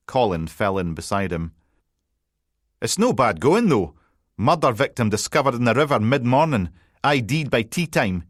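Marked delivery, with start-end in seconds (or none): 2.8-8.2 higher and a bit louder, teasing Scots banter; a light cheeky Scottish reporter voice; cocky journalist. Acted. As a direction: needle the detective with a grin. Narrator voices cocky journalist Colin.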